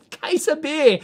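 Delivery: laughing through the words, slowed down; giggling as you tell the punchline. Real, not acted. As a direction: chuckle it out, amused. Laughing while retelling the beer story.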